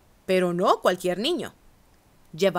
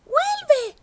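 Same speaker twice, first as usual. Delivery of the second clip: a much higher shouted call; 3 dB louder than usual. A narrator's reading. Wife calling after the runaway cookie, high shout. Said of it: "gritó su esposa".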